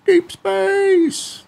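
hammed-up outer-space announcer; dramatic sci-fi announce. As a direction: boom it like a space epic title. Dramatic echo of the title.

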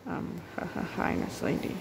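breathy, whispered nervous hi with a laugh; almost too shy to speak. Acted. Chief whispering hi nervously to Nurse Lady. Chief shyly greets date.

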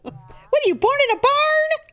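very high, drawn-out scolding; mom yelling. Retold or imitated. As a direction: yell it stretched out.

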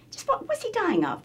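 very high-pitched insistent question; insistent mock-innocent query. Voice actor keeps questioning the producers, high-pitched insistence. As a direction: press the question in a high little voice.

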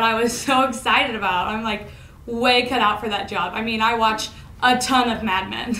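normal talking voice

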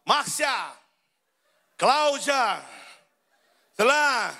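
high, carrying call-out; barista calling names. Retold or imitated. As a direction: imitate a counter clerk calling out orders. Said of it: "olha o tom de voz". (atendente chamando nomes em voz alta)

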